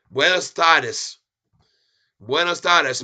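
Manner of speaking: loud slow greeting. Acted. Greeting viewers in Spanish.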